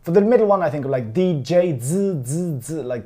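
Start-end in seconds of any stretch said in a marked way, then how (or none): 2.2-3.0 "z" with a buzzing, vibrating sound. Shown as a demonstration. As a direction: let the "z" buzz and vibrate. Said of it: "just vibrate a little bit while you're doing it".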